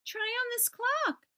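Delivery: high little-letter voice offering the clock; eager cry. Acted. Little C urging little I to try the clock, high. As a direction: pipe it up like a tiny eager helper. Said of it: "Little C cried".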